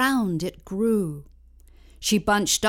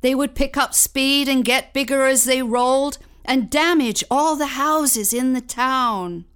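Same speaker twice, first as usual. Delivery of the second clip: ordinary delivery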